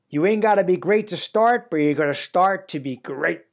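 mock Zig Ziglar's Southern US accent, hammed-up; bad Texan accent. Podcast host quoting a motivational guru in a bad Texan accent. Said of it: "I'm gonna try to do his accent here".

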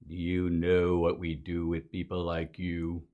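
a suspicious Klansman at the door, menacing and quiet; mock Klan leader. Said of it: "imaginative rendition".

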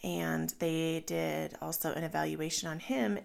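ordinary talk

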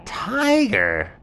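high, slow, drawn-out animal voice; animals asking. Acted. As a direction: stretch it out high and pleading. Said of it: "And they said".

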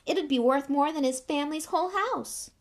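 high smug taunt; gloating schoolboy put-down; smirking. Acted. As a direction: jeer with lifted pitch. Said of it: "smirked Malfoy".

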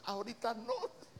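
high, hesitant little voice; a church sister who isn't ready to go; reluctant sister. Retold or imitated. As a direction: squeak out a nervous refusal. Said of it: "hay una hermana que dice".

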